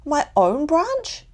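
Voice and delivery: high, slow startled squeak of a little koala; high frightened little squeak. A narrator's reading. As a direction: squeak it out in alarm. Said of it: "he squeaked".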